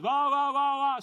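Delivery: loud, high-pitched yammering; imitated screaming.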